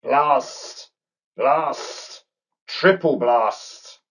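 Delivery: a cartoon snake spitting curses, slow on the last; snake hissing. A narrator's reading. Snake cursing after losing his crown, hissing frustrated snake voice. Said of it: "Simon hissed".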